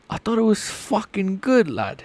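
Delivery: high, put-on English lad accent. Streamer defending his impression to chat, English accent. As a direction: protest in a mock English accent. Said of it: "your English accent is terrible".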